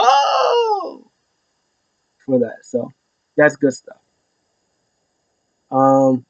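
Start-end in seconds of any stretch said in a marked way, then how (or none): 0.0-1.1 very high drawn-out "oh" imitating a crowd; crowd reaction. Crowd spotting the video, imitated high ooh. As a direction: mimic a crowd's long falsetto gasp. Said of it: "I think the crowd saw that".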